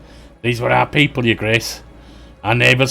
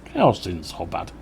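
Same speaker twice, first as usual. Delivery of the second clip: softer and slow, cold and unbothered; quiet menace; 5 semitones lower, 7 dB quieter, slower than usual. Acted. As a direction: let the threat sit low and easy. Rebel leader menacing the lord.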